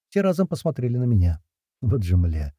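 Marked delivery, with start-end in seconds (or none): none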